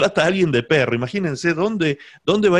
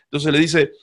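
usual speaking voice

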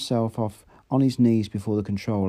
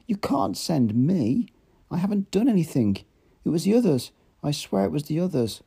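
much higher, panicky denial; frantic protest; 8 semitones higher than usual. A narrator's reading. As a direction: scramble to shift the blame. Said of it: "a violent and unseemly activity".

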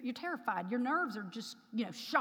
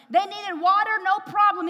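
preaching emphasis; 7 semitones higher, 11 dB louder than usual. Real, not acted. Preaching call and answer.

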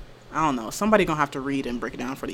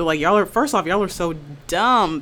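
ordinary delivery

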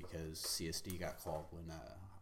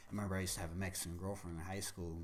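ordinary talk

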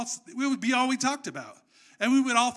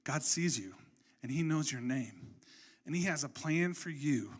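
soft, lower and tender, like whispering hope to a friend; hushed reassuring words, softer and lower; gentle encouragement; 5 semitones lower, 5 dB quieter than usual. Retold or imitated. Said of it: "says, like".

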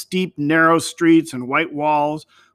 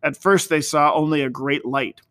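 plain reading voice

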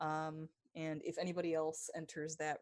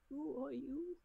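higher, puzzled character voice; 10 semitones higher, slower than usual. Acted. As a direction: ask it in a lifted character voice. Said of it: "And he's".

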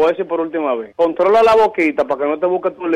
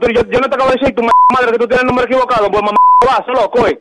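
real angry shouting down the phone, voice raised; furious yelling; 4 semitones higher than usual. Real, not acted. Angry victim yells at the prank caller. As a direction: roar back at the stranger, fed up.

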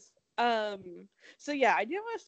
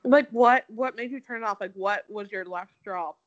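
talking around ice in mouth; mouth full; 8 dB louder than usual. Real, not acted. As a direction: ice cube in mouth while speaking. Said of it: "Sorry, I have ice in my mouth".

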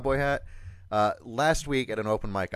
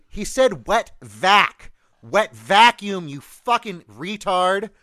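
slow, much louder Boston yell; Boston crowd scream; 7 semitones higher, 8 dB louder, slower than usual. Retold or imitated. The crowd screaming at the singer, a slow Boston yell. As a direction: drag out every word, screaming in a thick Boston accent. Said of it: "everyone's screaming".

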